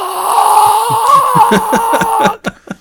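high shouted burst of laughter; loud shrieking laugh. Real, not acted. Shouted laugh after frustration.

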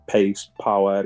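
ordinary talk

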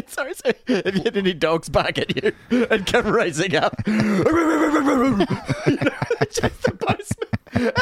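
laughing while talking; words breaking into giggles; trying not to laugh. Real, not acted. Laughing through the question.

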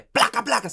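imitated gunfire in a TikTok fight; gunshot noises. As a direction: pop out placa placa like gunfire. Imitating gunshots in retold story.

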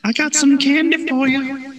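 high sleazy creep; creepy stranger voice. Acted. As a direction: tempt him like a creep.

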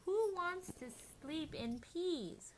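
high, drawn-out incredulous question; stretched-out, high-voiced disbelief; exclaiming. A narrator's reading. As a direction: exclaim it slowly, pitched right up. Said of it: "exclaimed Skipito".

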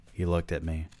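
usual speaking voice

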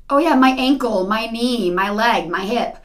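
exaggerated athlete's griping; mock complaining. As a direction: list the aches in mocking imitation, starting loud. Mocks constantly injured basketball players.

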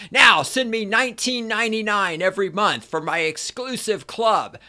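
loud, hard-sell dating guru; hammed-up influencer sales spiel; manosphere YouTuber. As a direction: pitch it forcefully like an online hustler. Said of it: "the manosphere equivalent of your ranger".

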